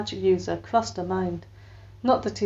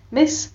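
Miss raised high and louder, a sharp correction; pointed correction; 8 semitones higher, 5 dB louder than usual. A narrator's reading. Beatrix corrects the wizard with a sharp high Miss. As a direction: snap the word Miss up high.

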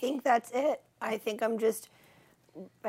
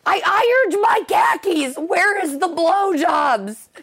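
higher, louder, slow whiny complaint; mock an indignant nice guy; entitled nice guy; 8 semitones higher, 7 dB louder, slower than usual. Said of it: "The one who's like".

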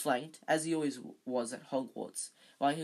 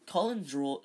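usual speaking voice

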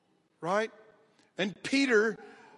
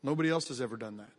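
hushed; 8 semitones lower, 8 dB quieter, much faster than usual. Real, not acted. Repeats after long pause.

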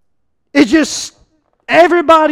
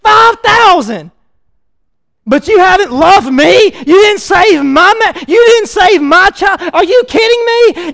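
shouting, louder and higher, hurt and furious; angry outburst at Jesus; 5 semitones higher, 8 dB louder than usual. Retold or imitated. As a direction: hurl the accusation at Jesus in full voice. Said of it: "Peter looks at Jesus and he says".

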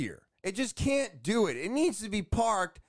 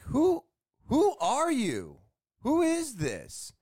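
high, slow, disbelieving questions; incredulous demand; 7 semitones higher, slower than usual. Retold or imitated. Homeowner to the woman stopping his workers, slow incredulous questions. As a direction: stretch each who out in disbelief.

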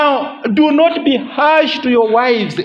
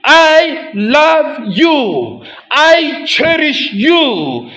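very slow, louder and tender; tender I love you; 5 semitones higher, 3 dB louder, much slower than usual. Retold or imitated. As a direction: say it slowly, meaning every word. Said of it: "when he speaks and says to the wife".

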